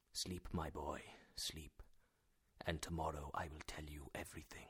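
soft and low, sinking towards a whisper; soothing. A narrator's reading. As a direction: gently lull the patient to sleep. Uncle soothes weak patient to sleep.

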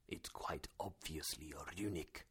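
whispered, very soft; hushed professor. A narrator's reading. Professor over parchment, whispered in awe. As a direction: breathe it in awe.

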